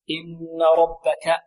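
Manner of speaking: slow إن ربك, lingering on the doubled n; full ghunnah. Shown as a demonstration. Teacher repeats the model for students, held ghunnah on إن. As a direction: hold the nun of إن through the nose. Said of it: "هنا غنة أكمل".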